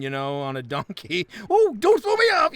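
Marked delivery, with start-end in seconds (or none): 1.5-2.5 high, loud squeal of panic; panicked Richard Pryor. Retold or imitated. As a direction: yelp as if slipping off a donkey. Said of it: "Richard Pryor riding down".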